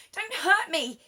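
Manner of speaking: mock-frightened lion whimper. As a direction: beg not to be hurt. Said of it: "he whimpered".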